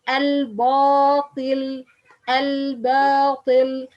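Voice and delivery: "al-batil" with the ba wrongly made heavy, loud and drawn out; slow "al-batil", backed, heavy ba coloured by the following letter; mistaken heavy ba. Shown as a demonstration. Teacher shows other people's mistake, heavy ba. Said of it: "other people pronounce because ba letter followed by ta".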